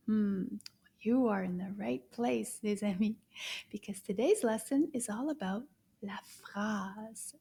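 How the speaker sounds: ordinary delivery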